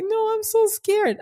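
high, slow whimpering plea; scared baby voice. Retold or imitated. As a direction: plead like a frightened child. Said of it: "I was acting like a real baby".

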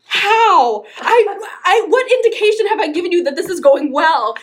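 high, loud, laughing disbelief; can't believe what she just heard; incredulous disbelief. Retold or imitated. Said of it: "And I'm like".

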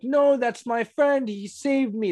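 high, drawn-out mock wail; pleading cry, imitated without real tears; imitated screaming and crying. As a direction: act out a desperate high-pitched plea. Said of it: "when he started screaming and crying".

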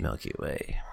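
whispering. Acted. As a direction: whisper it like a secret. Continues whispered listing.

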